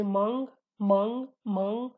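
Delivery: mang closed in the nose, no final stop; Slemani pronunciation. Shown as a demonstration. Teacher repeats the Slemani mang with its nasal ending. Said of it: "So I say".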